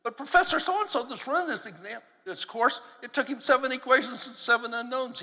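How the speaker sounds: lightly voiced student objection, pitched high; a hint of a baffled student; imitated protesting student. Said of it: "This guy says".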